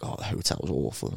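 mocking a couple on TV moaning about the hotel, hushed whinge; mock complaining whisper.

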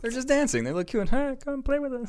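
very high, tiny creature voice; playful elf voice. Acted. Dancing elves luring someone in to play, playful high voice.

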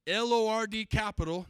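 each letter of "L O R D" punched loud; spelled out with emphasis. Shown as a demonstration. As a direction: drive home the capital letters. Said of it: "now you're seeing it".

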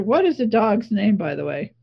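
normal talking voice

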